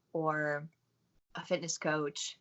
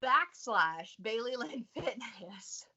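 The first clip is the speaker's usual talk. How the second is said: slow fading out-of-touch boomer; baby boomer voice; 4 semitones higher, 4 dB louder, slower than usual. Pretend. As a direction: trail off like a lost boomer. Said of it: "That's her baby boomer impression".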